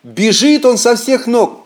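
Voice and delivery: ordinary delivery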